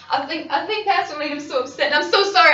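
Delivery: mother breaking down in guilt; distraught apology. Acted. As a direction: build to a loud, guilt-stricken cry of apology.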